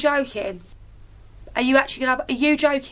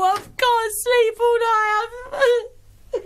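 tearful breakdown; 4 semitones higher than usual. Real, not acted. Exhausted contestant complains of feeling ill, crying. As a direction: voice climbing into real crying.

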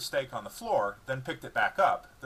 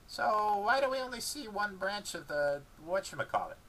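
higher dog voice, puzzled; 10 semitones higher than usual. A narrator's reading. Dog puzzling over the theory, puzzled dog voice. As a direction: ask like a confused pup. Dog asking about physics.

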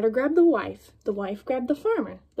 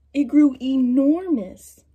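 slow and louder, stressing enormous; slow, emphatic; 5 dB louder, slower than usual. A narrator's reading. Reading to kids, slowly booming how big it grew. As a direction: draw out the size of it. Story builds size.

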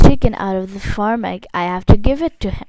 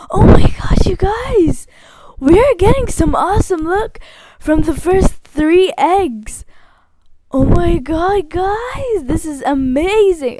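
excited; 6 semitones higher, 4 dB louder than usual. Real, not acted. Excited over luck.

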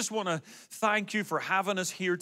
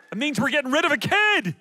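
loud, high, jubilant punchline; comic shout of celebration; gleeful exclamation; 8 semitones higher, 5 dB louder than usual. Real, not acted. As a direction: crow it to the whole crowd. Joking celebration of son's engagement.

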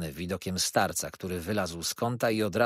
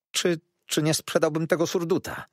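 higher, hesitant stammer; trembling; 7 semitones higher than usual. A narrator's reading. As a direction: stammer a timid request to a frightening man. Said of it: "drżąc cały".

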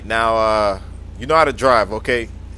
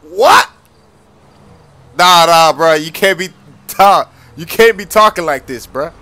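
loud, higher disbelieving scold; shocked outrage; 5 semitones higher, 9 dB louder than usual. Acted. Dad scolding son about his mom, outraged.

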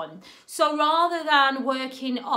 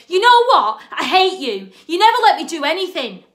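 put-on child yelling at mum, much louder and higher, fast at the end; angry shout; 4 semitones higher, 11 dB louder than usual. Child shouting at mum in the climax, furious yell. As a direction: scream it at her like a furious kid. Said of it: "really big argument".

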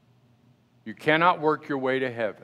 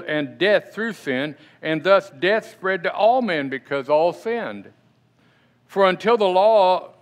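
forceful scripture reading; 3 semitones higher, 4 dB louder than usual. Acted. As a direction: read the verse out strong and loud to the congregation. Reads Romans aloud.

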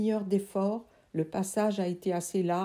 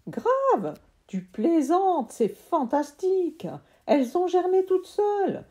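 much higher, loud delighted voice; thrilled uncle; 10 semitones higher, 6 dB louder than usual. Acted. Uncle thrilled by his sprouting seeds, high and loud. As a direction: burst with delight.

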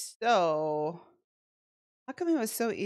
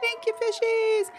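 much higher, sing-song thank-you; cute high voice; 14 semitones higher than usual. Pretend. Streamer thanks the fishy viewers in a high, cooing voice. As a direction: coo it up high and sweet.

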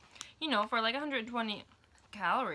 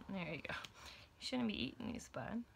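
whispering; 8 dB quieter than usual. Real, not acted. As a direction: hush it to the little one. Whispering to toddler.

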